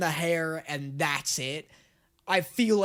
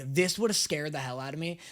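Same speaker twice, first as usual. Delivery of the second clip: ordinary talk, nothing marked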